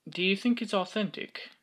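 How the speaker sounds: put-on playful British accent, lifted in pitch. Host asking viewers if it's authentic, mock-British accent. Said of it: "British voice".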